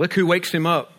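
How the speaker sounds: ordinary talk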